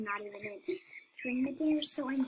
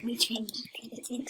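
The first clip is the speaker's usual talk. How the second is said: whispering. Real, not acted. Whispered correction.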